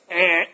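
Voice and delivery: imitated game-show wrong-answer buzzer; buzzer noise. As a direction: buzz them out for a wrong answer. Mock buzzer rejecting imagined excuse.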